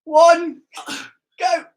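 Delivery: building countdown. Real, not acted. Counting down the challenge.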